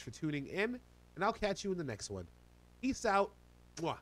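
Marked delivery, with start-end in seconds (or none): none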